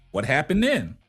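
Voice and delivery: normal talking voice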